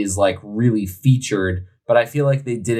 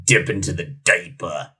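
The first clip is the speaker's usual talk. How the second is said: mock deep echo; 6 semitones lower than usual. Repeating the silly beer name.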